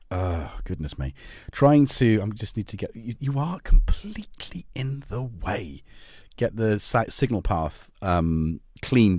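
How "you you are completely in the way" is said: slow, hushed aside to the cat; whisper to the cat. Real, not acted. Host whispers to the cat blocking the camera, hushed. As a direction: whisper it so the stream barely hears.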